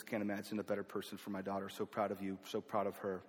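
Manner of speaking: quietly tender proud dad. Retold or imitated. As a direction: say it softly like a proud father. Father lovingly blesses the engagement.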